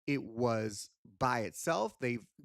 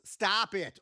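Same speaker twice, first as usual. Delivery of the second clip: louder, higher exasperated command; mock scolding; 6 semitones higher, 5 dB louder than usual. Handler stops his puppet, loud mock exasperation. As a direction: shut the cheeky puppet up.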